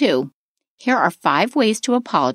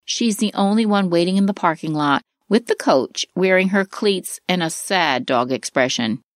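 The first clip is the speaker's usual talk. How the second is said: ordinary talk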